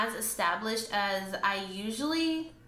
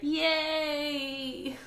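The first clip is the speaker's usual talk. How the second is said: higher wry celebration; mock cheer; 5 semitones higher than usual. Real, not acted. Vlogger cheering her broken plant piece, wry mock yay. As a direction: cheer a little too brightly.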